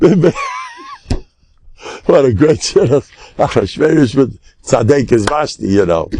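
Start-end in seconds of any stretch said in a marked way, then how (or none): none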